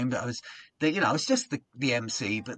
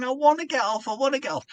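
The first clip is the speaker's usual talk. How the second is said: high whiny plea; pleading compere; 11 semitones higher, 4 dB louder than usual. Retold or imitated. Compere pleading back at the booing crowd, high whine. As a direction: beg to be let off. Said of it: "And I'm going".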